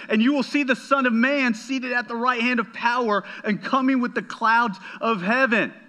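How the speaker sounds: high, ringing scripture declaration; impassioned preacher quoting a prophecy; bold proclamation. Retold or imitated. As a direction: proclaim it to the whole room, pitched well up. Preacher voices Jesus's climactic proclamation.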